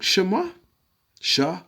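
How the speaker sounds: Shema, Shah high and rising; demonstrated pronunciation. Shown as a demonstration. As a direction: pitch up on shuh-MAH, then SHAH alone. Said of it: "the way you'd actually say it".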